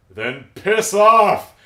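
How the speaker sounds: loud, high, drawn-out send-off; hammed-up doorman's yell; mock angry dismissal. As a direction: bellow it to get rid of an intruder. Retold doorman turns kid away.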